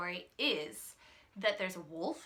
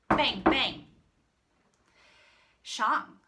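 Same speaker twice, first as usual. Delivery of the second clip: ordinary delivery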